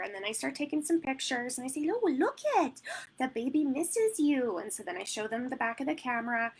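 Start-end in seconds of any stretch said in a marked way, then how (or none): 1.9-4.6 high oh, slow on misses you; sing-song baby talk. Retold or imitated. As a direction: coax with pretend sadness. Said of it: "I sound ridiculous when I'm doing sessions".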